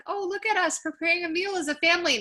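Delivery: high, gushing look-at-us voice; mock delighted. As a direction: coo it high, parodying family bliss. Mocking their earlier cooking enthusiasm.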